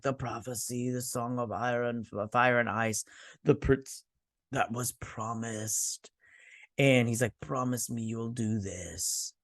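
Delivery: put-on soft, slow, dreamy old king; hushed drugged king; delirious king. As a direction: murmur the prophecy like a dying man.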